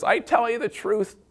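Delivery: high, hammed-up aunt at the table; Aunt Irma announcing. Aunt rising at holiday dinner, mock-dramatic high voice. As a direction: play the dramatic aunt with the pitch up. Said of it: "Aunt Irma stands up and says".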